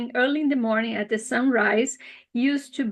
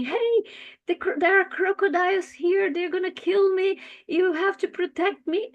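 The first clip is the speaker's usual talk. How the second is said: higher fish voice, anxious and complaining; 7 semitones higher than usual. Acted. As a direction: whine about the crocodiles like a small fish. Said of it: "the fish starts complaining".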